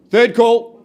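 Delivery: booming final call to the room; loud auction call. Real, not acted. Auctioneer calling the final bid.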